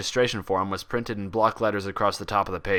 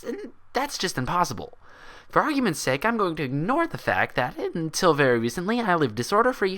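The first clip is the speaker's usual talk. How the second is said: put-on higher, indignant young mare pleading with the doctor; a sharp, disbelieving unicorn insisting she's sane; Twilight's voice; 8 semitones higher than usual. Twilight rejecting the doctor's diagnosis, higher and indignant.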